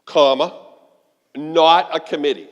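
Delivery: usual speaking voice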